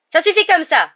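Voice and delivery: high, loud referee's rebuke; crying out. A narrator's reading. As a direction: break up the fight with a shout. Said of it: "s'écria madame Bibine". (cri autoritaire d'arbitre)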